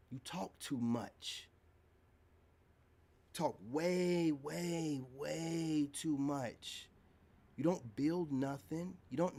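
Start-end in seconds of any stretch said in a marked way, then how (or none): 0.1-1.5 quiet and very slow put-down; slow scold. Real, not acted. Scolding a talkative critic, quiet and slow. 3.3-6.9 way way way too much, the last words very slow; drawn-out emphasis. Real, not acted. Scolding a critic, stretching the words out.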